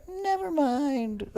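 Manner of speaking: high, drawn-out singsong quote; mock backtracking. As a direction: stretch it out high and softly mocking.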